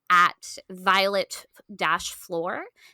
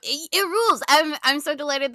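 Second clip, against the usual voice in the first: higher, louder "it rules"; excited agreement; 8 semitones higher, 4 dB louder than usual. Real, not acted. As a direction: burst out in delight. Enthusiastic echo.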